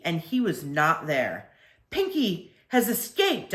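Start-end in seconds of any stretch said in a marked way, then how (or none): 1.9-3.5 shouted. A narrator's reading. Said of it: "I shouted".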